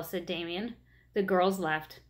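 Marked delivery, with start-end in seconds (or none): none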